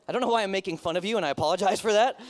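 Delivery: usual speaking voice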